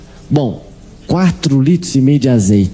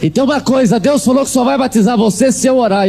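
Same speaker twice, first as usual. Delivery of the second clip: higher and raised, teasing; mock bossy taunt; 8 semitones higher than usual. Maid Ted teasing the preacher, raised mock taunt. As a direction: lord it over him with a call.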